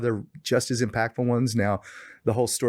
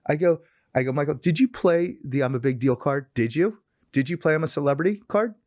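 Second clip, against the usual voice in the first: excited retelling; 7 semitones higher than usual. Retold or imitated.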